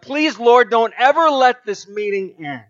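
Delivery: loud, high, pleading prayer; fervent plea. Retold or imitated. Pleading prayer in retold story.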